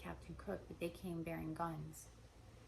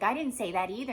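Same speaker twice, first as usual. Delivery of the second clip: loud mock retort; defensive; 3 semitones higher, 9 dB louder than usual. Other side of the acted squabble, defensive. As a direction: fling the denial back loudly. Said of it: "You're defensive".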